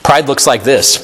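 normal talking voice

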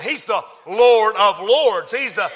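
slow, loud shouted proclamation; booming preacher cry; preaching at full force. Acted. Preaching climax.

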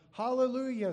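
a high, loud ringing Hallelujah; shouted hallelujah. Acted. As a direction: cry it out like a great multitude. Heavenly multitude cries hallelujah.

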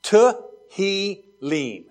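Tehillim drawn out slowly, one syllable at a time. Shown as a demonstration. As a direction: break Tehillim into te, hi, lim. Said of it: "Here's your first one".

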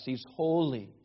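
ordinary delivery

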